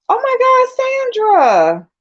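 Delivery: high, drawn-out cry of recognition; overjoyed squeal. Real, not acted. Spotting Sandra in the chat, overjoyed squeal. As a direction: squeal it long and high in real delight. Spots another missed friend arriving.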